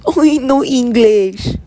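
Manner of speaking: high, loud imitation of a Spanish accent; mock Spanish accent. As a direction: mimic her in a slow, exaggerated Spanish accent. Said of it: "it's this Spanish girl".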